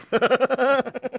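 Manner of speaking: a short, high cackle at his own joke; high mocking laugh. (hohes, spöttisches Lachen)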